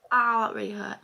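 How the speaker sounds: real sharp pain. Real, not acted. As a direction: wince out an ow. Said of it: "split my lip".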